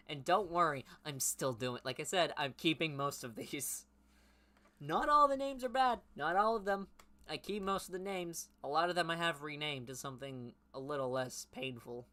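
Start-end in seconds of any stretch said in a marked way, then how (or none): 4.8-8.5 high, loud defensive outburst; emphatic protest. Real, not acted. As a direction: protest it at a high pitch, loudly. Protesting about her own bad names.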